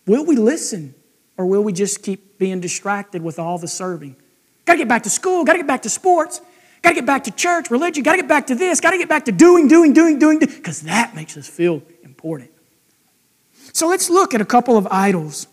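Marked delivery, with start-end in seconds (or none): 4.6-10.6 imitating people rushing back to busy lives, building to a frantic pitch; mock frantic hurry. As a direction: pile up the gottas like someone who can't stop. Mimicking busy restless culture.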